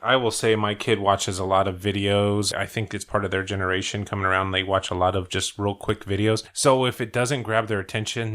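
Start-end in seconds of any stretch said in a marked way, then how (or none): none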